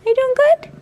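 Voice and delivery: high, loud cooing to the dog; pet talk. Real, not acted. Baby talk to the family dog.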